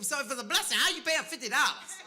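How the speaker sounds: loud, high, laughing jab; mocking challenge. Friend loudly mocking the pastor for paying fifty dollars. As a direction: needle him about paying for a blessing.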